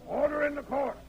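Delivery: stern shout. Acted. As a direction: silence the room with one shout. Judge calls court to order.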